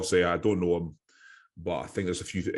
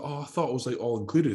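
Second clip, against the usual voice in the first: higher, clueless tone; mock naive lad; 5 semitones higher than usual. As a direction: sound innocently surprised. Lookalike voiced naive.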